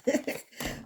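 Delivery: brief real chuckle; short laugh. Real, not acted. Reader amused at having literally said it, short laugh. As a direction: let out a quick, spontaneous laugh. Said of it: "I'm laughing 'cause I literally said it".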